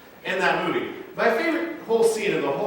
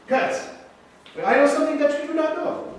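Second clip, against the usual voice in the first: high, teasing fencer's boast; playful duelist taunt; 13 semitones higher, 3 dB louder than usual. Acted. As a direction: gloat over your secret, pitched high. Inigo's taunt acted in sermon.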